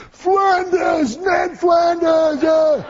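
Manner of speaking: drawn-out, high-pitched jeering chant; crowd yelling. Retold or imitated. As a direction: imitate rowdy students taunting the speaker. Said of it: "they're all yelling out".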